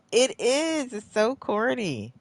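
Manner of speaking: high, loud laughing complaint. Real, not acted. Player finding the glitch so corny, laughing.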